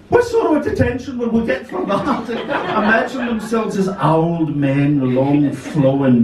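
high, laughing delivery. Real, not acted. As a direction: laugh through the words. Teller laughs at the idea.

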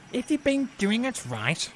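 high, sharp retort; retorting Fergus. A narrator's reading. Fergus lecturing Duck about doing it right, sharp retort. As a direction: snap back in a high voice. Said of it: "retorted Fergus".

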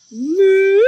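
loud, high, wavy cow moo. Acted. Reader asks children to moo along over hills, rolling loud moo. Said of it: "moo like cow going up and down the hills".

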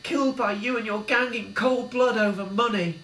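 builds high and loud in anger; Billy condemning Arnie's murders; rising fury. A narrator's reading. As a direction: turn on the killer with hate. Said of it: "sensed a change of tone".

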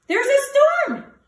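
high worried cry. A narrator's reading. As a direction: cry it out in alarm. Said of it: "cries Hare".

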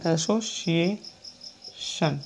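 teacher repeating the word softly; soft slow repeat. Shown as a demonstration. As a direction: repeat it gently and slowly. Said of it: "इसको सुना".

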